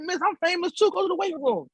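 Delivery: high-pitched mock indignation; squeaky retold protest; comic insistence. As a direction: insist on your fame, amused. Insisting he is famous.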